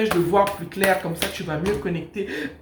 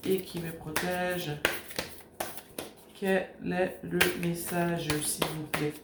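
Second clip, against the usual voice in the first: hushed invocation; 5 dB quieter than usual. Acted. Tarot reader softly invoking her guiding spirits, hushed prayer. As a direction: call on the universe gently while shuffling. Prays to the universe over cards.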